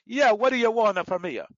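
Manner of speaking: mock Italian with a little "ah" on everything; high, loud start to a fake Italian accent; phony Italian accent. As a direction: ham up an Italian accent, falling away at the end. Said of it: "everything had a little ah on it".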